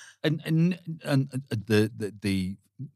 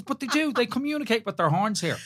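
high mock voice of Jenny, pitched up; imitating Jenny; 11 semitones higher than usual. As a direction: playfully mimic his wife's complaint, much higher. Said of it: "And she says".

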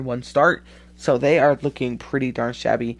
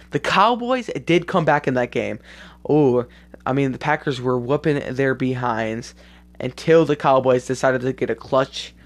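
normal talking voice

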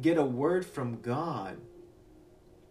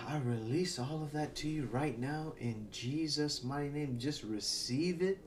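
soft prayer; 4 semitones lower, 8 dB quieter than usual. Acted. Soft prayer over listeners.

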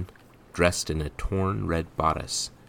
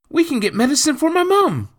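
very high, loud jubilant cry; crowing boy; 10 dB louder, faster than usual. A narrator's reading. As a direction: shout the good news as an elated child.

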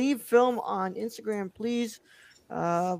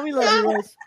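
normal talking voice

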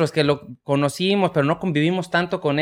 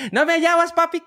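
put-on much higher little-boy voice; child's voice; 12 semitones higher than usual. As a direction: play the smiling kid teasing his dad. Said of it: "y me dice". (imitando al niño sonriente con voz aguda)

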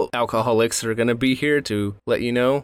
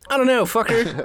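high mocking retort; high pitched exasperated put-down; 12 semitones higher than usual. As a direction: snap it back with sarcasm.

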